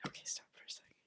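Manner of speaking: hushed off-mic aside to the interviewer; real whisper asking to pause. Real, not acted. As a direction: whisper a quick stop request. Pauses the recording mid-story.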